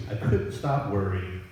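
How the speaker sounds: ordinary delivery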